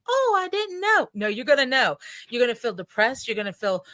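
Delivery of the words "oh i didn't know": high, slow, mock-innocent; mocking naive voice. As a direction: play dumb, drawn out and high. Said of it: "It isn't like".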